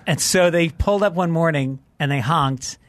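ordinary delivery

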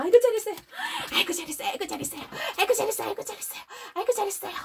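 high rapid good-dog praise; shower the dog with praise; repeated praise. Shown as a demonstration. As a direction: chant of doting praise. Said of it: "칭찬을 해줘야 합니다".

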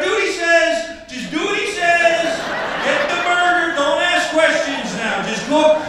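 high, loud, urgent orders; panicked shouting. Acted. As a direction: shout orders as if under threat. Panicked worker facing suspected terrorist.

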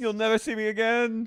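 high, loud theatrical farewell; dramatic exit. Pretend. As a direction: play the grand diva exit.

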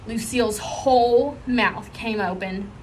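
usual speaking voice

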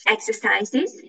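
ordinary delivery

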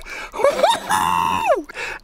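long, high victory cry; triumphant whoop. Real, not acted. Angler celebrating an unbelievable fish, triumphant whoop. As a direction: let out a rising, elated whoop.

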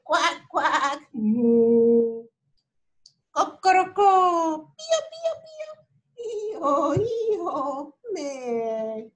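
oinks, bleats, quacks, moo and peeps; animal noises. Acted. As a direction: imitate each farm animal. Animal sounds imitated. (sonidos de animales)

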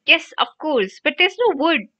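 high, fretful cry; flustered and despairing; distressed cry. A narrator's reading. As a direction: wail it helplessly in a crisis. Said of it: "Hermione cried".

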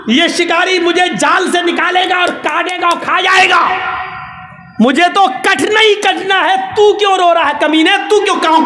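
pigeon berating the crow for crying; angry pigeon. Retold or imitated.

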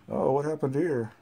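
usual speaking voice

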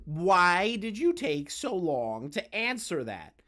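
slowly drawn-out question; slow, pointed repeat. Real, not acted. Debater repeating a question to someone slow to answer, drawn out. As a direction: stretch out every word as if forced to repeat it. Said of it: "Do I have to repeat myself again?".

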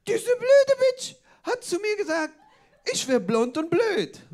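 high, loud, drawn-out indignation; mock outraged girl. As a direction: imitate an indignant girl complaining loudly. Girl's outraged complaint, acted.

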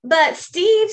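a friend needling the show-off; Bob's voice. Acted. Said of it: "says Bob".

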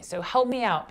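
usual speaking voice

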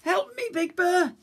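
desperate call from the water; little cub crying out in the stream; cub crying for help. A narrator's reading. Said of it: "Little Bear cried".

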